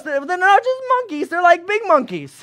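high, silly explaining voice; mock townsman. A local explains the apes, high-pitched.

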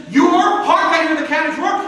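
calm the actor onto his mark; frantic director. Retold or imitated. Nervous director, relieved.

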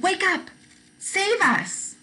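high, urgent plea to wake up; scared cry for help. Acted. Frightened disciples calling to sleeping Jesus. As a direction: cry out over the storm. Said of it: "A violent storm scared the disciples".

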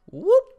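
loud rising falsetto whoop; quick playful whoop, jumping high. Pretend. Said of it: "I'm just gonna be like".

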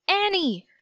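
high shouted name; a boy shouting up to his sister. A narrator's reading. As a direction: call her name up into the dark. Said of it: "Jack shouted".

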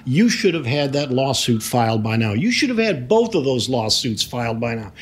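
high-pitched mock yelling of an angry client; retold angry yelling. Lawyer retells his client's phone rant, acted angry yelling. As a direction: reenact his rant, pitch climbing as it goes. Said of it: "he started screaming and yelling".

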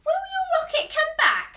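a little pig asks eagerly; high hopeful question. Acted. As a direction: Pig asks Tigger if the rocket returns.

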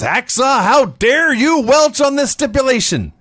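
high-pitched indignant wrestler challenge; mock outrage. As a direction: imitate an outraged heel calling someone out.

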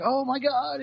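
a mock gasp of horror at his own blood; high, alarmed retold outcry; horrified cry. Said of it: "And he goes like".

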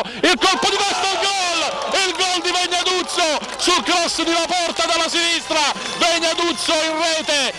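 rising excited goal call. Real, not acted. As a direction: burst out calling the goal. Goal call in live commentary.

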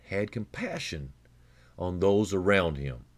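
ordinary delivery

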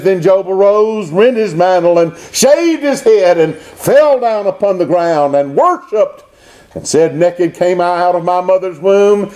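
high, declaimed Bible verse; ringing pulpit recitation; preached scripture reading. Real, not acted. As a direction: proclaim the verse with building fervour.

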